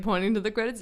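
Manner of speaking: normal talking voice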